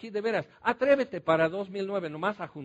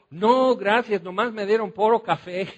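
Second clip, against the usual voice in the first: lively, louder Sierra Negra accent with a laugh, imitating the ranch folk; 3 semitones higher, 6 dB louder than usual. Chatting over dinner with his wife, answering in a Sierra Negra accent. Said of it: "Otra vez estoy hablando así".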